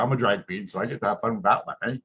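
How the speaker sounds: plain reading voice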